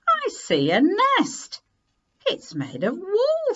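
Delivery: put-on high, slow, wondering child voice; excited child. A narrator's reading. Children spotting the wool nest in the tree, excited. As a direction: point it out in delight.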